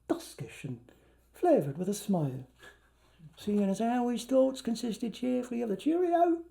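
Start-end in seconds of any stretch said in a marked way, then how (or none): none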